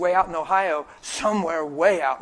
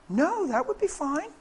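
lilting high voice, softer, rising at the end; twelve-year-old girl; 10 semitones higher, 4 dB quieter than usual. Retold or imitated. Twelve-year-old says praying for her foot would be fine, high girlish voice. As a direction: imitate a girl agreeing, high-pitched. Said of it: "She said, twelve years old".

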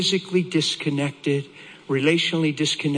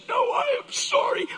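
very high, emphatic imitation of the wife; insistent apology. Wife refusing her husband's apology, insistent. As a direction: insist on being sorry, high-voiced.

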